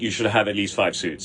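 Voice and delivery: normal talking voice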